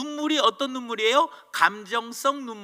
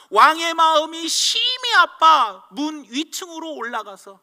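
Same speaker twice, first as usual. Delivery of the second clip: raised reading voice; 6 semitones higher, 8 dB louder than usual. Acted. Reading David's grief verse.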